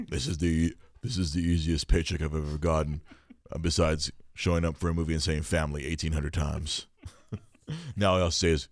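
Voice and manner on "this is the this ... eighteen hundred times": lower, softer mock Vin Diesel aside; smug low mutter of an easy paycheck; imitating Vin Diesel. Vin Diesel gloating quietly about his easy paycheck, lower and softer. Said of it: "He is quietly".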